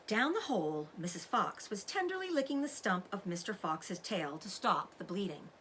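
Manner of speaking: usual speaking voice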